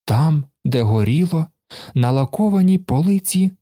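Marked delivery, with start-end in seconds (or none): none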